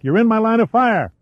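high, urgent shrieked warning; frantic high-pitched protest, not full volume. A narrator's reading. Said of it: "she shrieked back".

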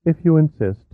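stiff and cold. A narrator's reading. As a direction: say it stiffly, offended. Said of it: "she said stiffly".

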